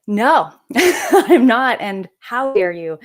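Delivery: slow denial broken by an incredulous laugh; laughing disbelief. Retold or imitated. Teen denying the doctor's accusation, laughing in disbelief. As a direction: laugh it off in shock.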